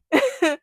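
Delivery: a burst of high laughter; loud high laugh. Real, not acted. Laughing at her own fear, loud high laugh. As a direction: let out a big high laugh. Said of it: "laugh after the line".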